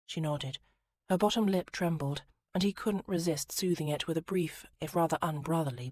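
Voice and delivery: usual speaking voice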